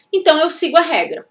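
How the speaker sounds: ordinary talk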